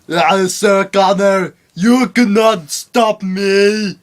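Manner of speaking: put-on slow, loud Austrian accent; Arnold Schwarzenegger voice. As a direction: drawl it like Schwarzenegger. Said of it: "Like Arnold Schwarzenegger".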